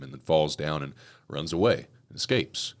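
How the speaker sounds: normal talking voice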